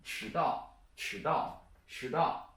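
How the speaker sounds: "迟到" repeated, 迟 rising, 到 falling; second-tone demo. Shown as a demonstration. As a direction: drill "迟到" with a rising tone on 迟. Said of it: "the second one is".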